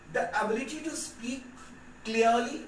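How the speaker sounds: slow, clear dictation pace. Shown as a demonstration. Teacher dictating slowly to students. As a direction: spell it out slowly for note-taking. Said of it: "देखो ध्यान से".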